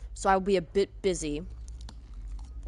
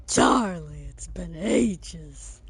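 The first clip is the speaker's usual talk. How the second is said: slow, drawn-out character voice; quoted character; 5 semitones lower, much slower than usual. Acted. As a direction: stretch it out slowly like a quoted line. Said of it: "If anybody gets that reference".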